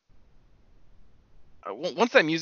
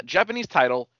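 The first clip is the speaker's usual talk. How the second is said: normal talking voice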